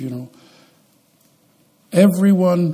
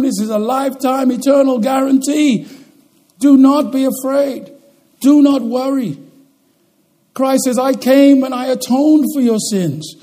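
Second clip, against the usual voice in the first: raised, ringing pulpit voice; rousing preaching; 8 semitones higher, 5 dB louder than usual. Acted. Pastor assuring his flock of God's guarantee, rousing. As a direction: lift the room with conviction.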